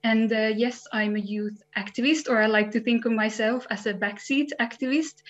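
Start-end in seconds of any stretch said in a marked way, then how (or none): none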